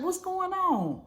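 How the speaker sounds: high, slow puzzled question; imitating his confusion; confused husband. Husband asking what's going on, puzzled. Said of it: "He was like".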